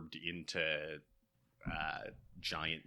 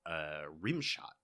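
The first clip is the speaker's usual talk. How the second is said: usual speaking voice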